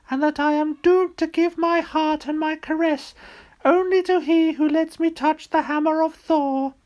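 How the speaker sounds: high falsetto maiden voice, loud and pleading; a frost giant faking a maiden's high voice; lovelorn maiden. Acted.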